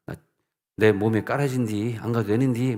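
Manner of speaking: light Jeolla dialect grumble; mildly voiced Jeolla dialect reluctance; 전라도 사투리로 가볍게 투덜대기. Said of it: "전라도 사투리 쓰는 거였어".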